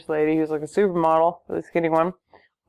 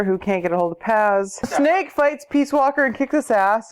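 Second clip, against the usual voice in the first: ordinary talk, nothing marked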